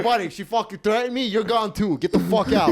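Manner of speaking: high, loud mock ejection call; umpire-like ejection. Doorman ejects the tall husband, umpire-like shout. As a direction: throw him out like an umpire. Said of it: "You're like a fucking umpire".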